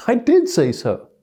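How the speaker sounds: high, loud and slow; wife correcting her husband, high and deliberate; insistent wife. Retold or imitated. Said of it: "What does she say?".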